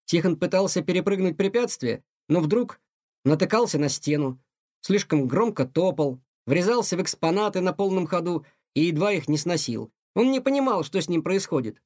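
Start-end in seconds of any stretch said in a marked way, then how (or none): none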